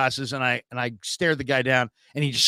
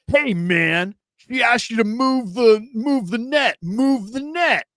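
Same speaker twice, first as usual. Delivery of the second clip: higher, slow mocking busybody voice; bossy stranger; 8 semitones higher, 4 dB louder, slower than usual. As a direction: mimic a preachy bystander drawing out his order. Stranger lecturing him at field.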